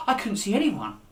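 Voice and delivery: usual speaking voice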